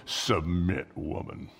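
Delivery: low, gruff joking command; a joking caveman order to the wife; mock domineering husband. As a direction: play the bossy husband for a laugh, soft and low. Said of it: "We joke".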